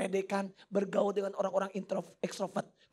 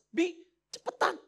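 high, drawn-out mock urgency; urgent plea; 8 semitones higher, 4 dB louder, slower than usual. Wife urges husband to come quickly, high urgent call. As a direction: imitate a wife calling out to hurry. Voicing wife urging him to hurry.

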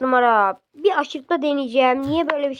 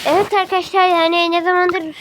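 excited youtuber; 6 semitones higher, 3 dB louder than usual. Real, not acted. Addressing viewers.

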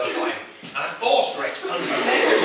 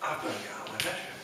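hushed, hurried, low panic; whisper-quiet retold fear, rushed; terrified; 6 semitones lower, 12 dB quieter, faster than usual. Boy in a power cut plans escape, hushed and scared. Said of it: "completely terrified".

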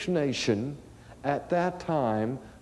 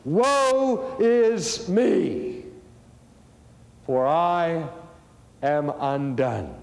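slow, louder anguished wail on woe; cry of terror; 4 dB louder, much slower than usual. Retold or imitated. Isaiah seeing God's holiness, a drawn-out cry of woe. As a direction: draw out Woe in dread. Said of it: "he cries out in terror".

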